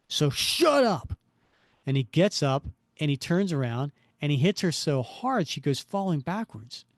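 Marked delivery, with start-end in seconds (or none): 0.1-1.2 slow, high burst of temper; angry shout. Acted. Crook silences the woman before striking her, angry. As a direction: explode on the last words, drawn out.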